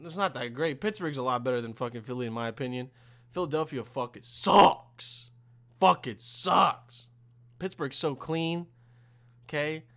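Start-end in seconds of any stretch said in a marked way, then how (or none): 3.3-7.1 "sucks" loud and drawn out; emphatic contempt. Real, not acted. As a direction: punch and stretch the word "sucks". Trashing Philadelphia by comparison.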